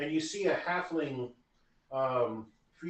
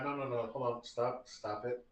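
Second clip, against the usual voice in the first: usual speaking voice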